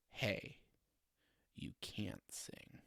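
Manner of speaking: almost whispered "you can't sing"; hushed judge verdict. Retold or imitated. Simon Cowell crushing a contestant, nearly whispered. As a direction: drop it to a breathy verdict. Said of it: "the other outcome".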